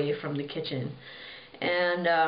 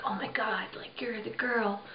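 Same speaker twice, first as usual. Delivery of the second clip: softer, stunned whisper; awed whisper; 4 semitones higher, 5 dB quieter than usual. Retold or imitated. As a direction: breathe it out in amazement. King discovers her dress.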